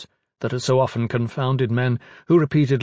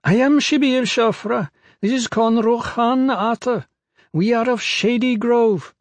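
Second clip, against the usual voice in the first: high-pitched pygmy woman; 11 semitones higher, 3 dB louder than usual. A narrator's reading. Pygmy woman greeting arriving strangers, high-pitched. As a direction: pitch it right up, held and deliberate. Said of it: "her voice high-pitched".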